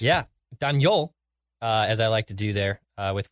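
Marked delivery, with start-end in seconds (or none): 0.0-1.1 Daniel in a thick French accent, stressed at the end; heavy French accent. Shown as a demonstration. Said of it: "with the French, heavy French accent".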